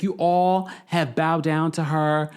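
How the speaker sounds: drawn-out frustrated retelling. Retold or imitated. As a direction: imitate a fed-up friend, word by word. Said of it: "she gets really frustrated".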